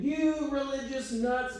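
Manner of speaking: mocking driver. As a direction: jeer at them from a car window. Driver yelling while honking.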